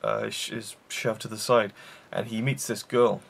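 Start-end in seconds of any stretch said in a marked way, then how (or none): none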